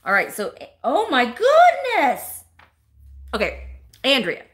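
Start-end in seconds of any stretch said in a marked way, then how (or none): 0.8-2.4 high, loud, drawn-out amazement; real gushing surprise; delighted surprise. Real, not acted. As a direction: exclaim slowly and high at a gift. Surprised by gift in the package.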